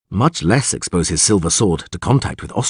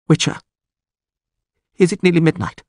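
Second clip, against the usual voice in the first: high, nervous voice; anxious question; 9 semitones higher, faster than usual. A narrator's reading. Bound lord asks the witcher the hour, anxious. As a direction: ask with dread, pausing after the name. Captive dreads the monster's hour.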